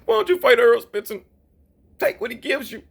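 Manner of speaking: high, loud mocking voice; mocking Charlo. Mocking Jermell Charlo's changed tune, high and loud. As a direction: mimic him backing down. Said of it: "Jermell Charlo went from".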